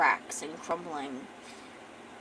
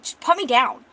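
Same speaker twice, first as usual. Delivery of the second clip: louder, insistent protest at being carried; protesting demand; 3 semitones higher, 8 dB louder than usual. Acted. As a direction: demand it firmly and forcefully. First take, protesting being carried.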